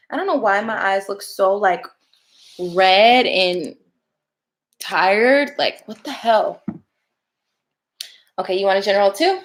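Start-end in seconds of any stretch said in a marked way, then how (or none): none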